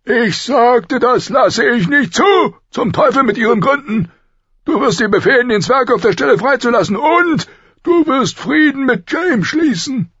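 loud, high, emphatic shouting; enraged royal command; furious king. A narrator's reading. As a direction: bellow the orders, overriding all objection. King shouts down Ned's excuse.

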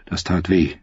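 plain reading voice

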